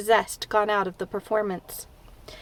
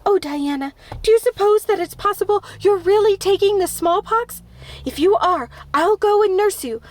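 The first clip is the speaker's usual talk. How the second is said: anxious pleading; 6 semitones higher, 3 dB louder than usual. A narrator's reading.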